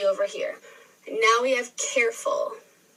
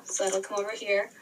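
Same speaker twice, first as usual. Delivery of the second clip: ordinary talk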